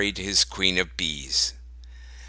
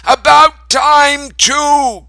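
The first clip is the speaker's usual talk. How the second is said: high, loud shout; Grandma calling out; 9 dB louder, much slower than usual. A narrator's reading. Grandma calls back to Grandpa from her window, loud shout. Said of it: "called Grandma".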